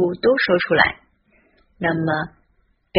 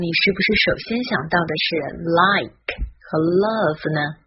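normal talking voice

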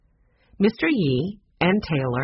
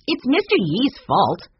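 high, indignant blame; petulant accusation; accusing; 7 semitones higher, 4 dB louder than usual. A narrator's reading. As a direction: shift the blame, voice raised. Accuses the neighbour, pointing.

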